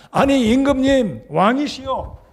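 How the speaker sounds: high, loud indignant outcry; prophet's angry protest, pitched high; enraged Elisha. Acted. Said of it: "엘리사가 대노합니다".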